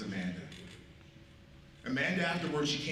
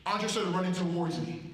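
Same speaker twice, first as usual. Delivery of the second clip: ordinary delivery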